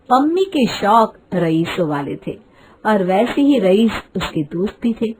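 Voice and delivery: plain reading voice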